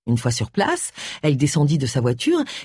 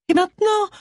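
whimper. A narrator's reading. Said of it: "gémit Brenda".

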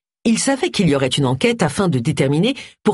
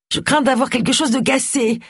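strangled voice; 7 semitones higher than usual. Acted. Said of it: "dit-elle d'une voix étranglée".

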